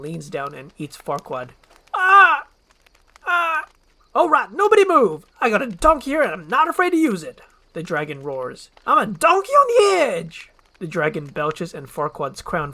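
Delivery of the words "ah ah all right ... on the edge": very high, loud, fast; high loud hyper shout; frantic Donkey. Acted. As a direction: burst in hollering. Donkey bursting in.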